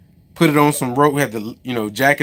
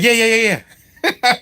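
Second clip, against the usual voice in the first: mock eager yeahs, pitched much higher; dude's breezy yes; 10 semitones higher than usual. The dude brushing off the unloading question, high mock yeahs. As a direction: toss off yeahs like it's no problem. Dude voiced in retold story.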